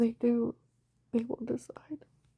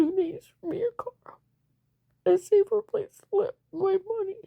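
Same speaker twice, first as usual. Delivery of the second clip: tearful plea; 7 semitones higher, 4 dB louder than usual. Real, not acted. Tearful demands.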